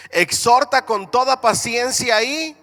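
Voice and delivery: loud, emphatic pulpit reading; scripture reading. Acted. Preacher reading Paul's charge to the church, emphatic scripture reading. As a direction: project each verse, lifting the last word for the congregation. Reads scripture aloud to congregation.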